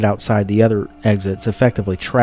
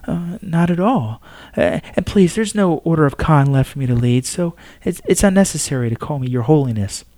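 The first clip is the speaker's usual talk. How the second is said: higher, gentle and humble; reassuring Pope; 6 semitones higher than usual. Acted. Pope tells the astronomer to drop her title, gentle. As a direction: wave off the title kindly.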